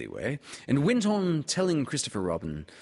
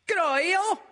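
put-on much higher, squeaky child's voice; high, eager squeaky greeting; squeaky little Roo; 8 dB louder than usual. A narrator's reading. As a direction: squeak it out like an excited little kangaroo. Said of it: "he squeaked".

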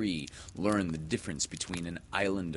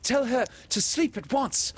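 put-on high, loud boy's command; high-pitched, insistent demand; almost ordering; 5 dB louder than usual. A narrator's reading. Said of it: "John almost ordered".

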